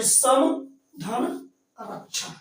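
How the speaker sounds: सम धन रक्षण spelled out slowly, part by part; slow breakdown. Shown as a demonstration. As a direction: split the word apart slowly. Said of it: "संरक्षण".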